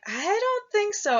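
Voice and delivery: high, swooping note of doubt; skeptical kid. Acted. Boy doubts the fox's wedding plans, skeptical. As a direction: pitch it way up to pour doubt on the boast. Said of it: "And the kid's like".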